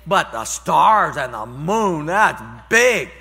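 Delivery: slow, loud, playful amazement; mock awe. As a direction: act hugely impressed for a child. Grandpa teasing amazement.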